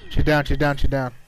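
loud urgent she's down; shouting callout. Real, not acted. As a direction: yell it to the squad. Teammate knocked mid fight.

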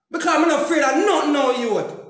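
rising into a furious shout at his enemies; angry shout. Real, not acted. Ranting man shouting threats at those who betrayed him, furious. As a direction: yell the threat, pitch climbing high.

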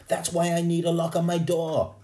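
put-on high, loud outburst from an exasperated kid; indignant protest. A narrator's reading. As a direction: protest loudly to your mother, pitch right up. Boy protesting to his mother.

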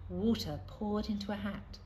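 ordinary delivery, nothing marked

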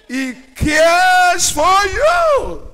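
high, slow, shouted "He cares for you"; preaching shout. Acted. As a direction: cry it out to the whole church. Preacher's climax line.

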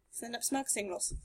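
normal talking voice

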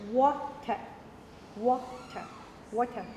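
"water" repeated with a careful w; rounded w. Shown as a demonstration. Teacher drilling the class, "water" with a clear w. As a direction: model "water" word by word, lips rounded. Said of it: "look at my lips".

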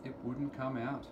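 usual speaking voice

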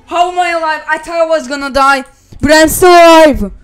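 loud disbelieving shout; amazed shouting. Real, not acted. Surviving against the odds.

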